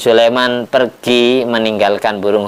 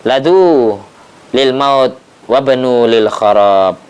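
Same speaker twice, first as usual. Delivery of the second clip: solemn proverb; much slower than usual. Acted. Pigeon's warning to the people, slow and solemn. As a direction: intone it like an old proverb.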